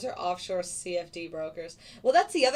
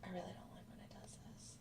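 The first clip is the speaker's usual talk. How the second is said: whispering; 19 dB quieter than usual. Real, not acted. Muttering to himself at chart.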